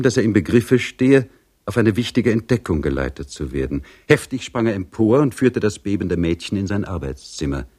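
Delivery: ordinary talk, nothing marked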